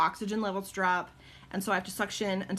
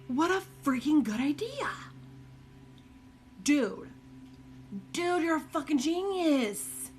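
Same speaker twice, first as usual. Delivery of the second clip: excited praise; 7 semitones higher than usual. Real, not acted. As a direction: gush over a brilliant idea. Excited praise for a chat idea.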